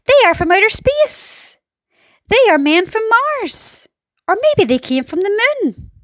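high, amazed crowd cries; excited high-pitched exclaiming; excited shouts. A narrator's reading. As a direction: call out wild guesses in a high voice. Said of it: "they shouted".

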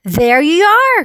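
drawn-out rising call. A narrator's reading. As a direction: call it out triumphantly, stretching the words. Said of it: "called the chief of police".

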